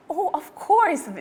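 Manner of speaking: delighted surprise; mother amazed by polite request; surprised mother. A narrator's reading. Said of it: "Her mother was so surprised".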